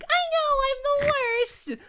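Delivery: very high, drawn-out head tone; super high head voice. Shown as a demonstration. Singer demonstrating head voice, super high and stretched. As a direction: float it way up into head voice. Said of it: "head voice, like, the super high".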